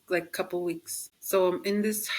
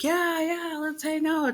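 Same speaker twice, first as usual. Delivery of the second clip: much higher, overly bright yes; mock eager agreement; 10 semitones higher than usual. Friend making plans, answered with hammed-up eagerness. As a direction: fake enthusiasm to make plans. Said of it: "I'll be like".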